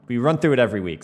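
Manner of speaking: ordinary delivery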